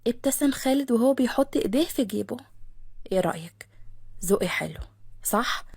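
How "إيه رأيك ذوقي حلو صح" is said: lower, softer self-satisfied man; proud of his taste, lower; Khaled voiced. Khaled asks about gift. (خالد بصوت أوطى فخور)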